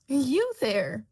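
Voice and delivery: loud, imperious shout; queen shouting at the Duchess. A narrator's reading. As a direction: bark across the garden. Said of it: "the queen shouts".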